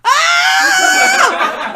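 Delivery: high, loud delighted shriek; sudden high scream of joy; excited scream. Retold or imitated. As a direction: shriek in happy shock. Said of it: "and I went".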